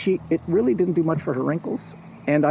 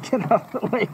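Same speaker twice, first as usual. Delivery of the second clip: shooing the dog; 6 semitones higher than usual. Real, not acted. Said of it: "I'm telling a story".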